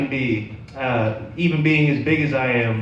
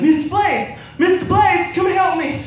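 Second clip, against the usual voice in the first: put-on much higher, pleading child's voice; scared little kid. Naked little boy calling his teacher for help, high and panicked.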